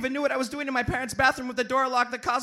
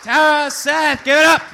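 calling for applause; 5 semitones higher, 12 dB louder than usual. Real, not acted. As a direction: stretch the names and shout them out.

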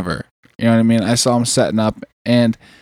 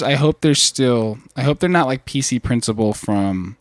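usual speaking voice

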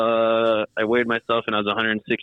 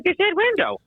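high whiny protest; high mock complaint; exasperated. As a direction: complain much higher like you're fed up. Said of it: "I'm like".

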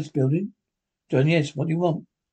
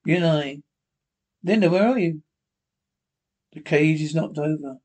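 ordinary talk

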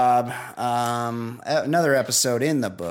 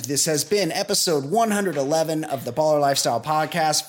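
podcast announcer sign-off; 4 semitones higher than usual. Acted. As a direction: stretch out each name like a show announcer. Reads the show sign-off.

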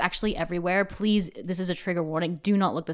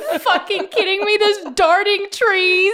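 high, loud mock outrage; hammed-up panicked disbelief; freaked-out husband; 12 semitones higher, 8 dB louder, slower than usual. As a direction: imitate a husband losing it, drawing out the last words. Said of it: "Sean's, like, really freaked out".

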